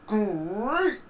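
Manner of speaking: cartoon tiger mascot, big and booming; Tony the Tiger voice. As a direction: boom it out like a cereal-box tiger. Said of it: "Tony Tiger voice".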